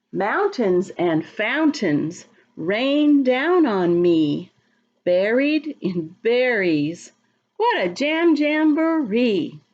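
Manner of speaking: put-on slow, sing-song rhyme for small children; slow rhyming read-aloud. A narrator's reading. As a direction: savour each berry word slowly, like a chant. Reads rhyming picture book aloud.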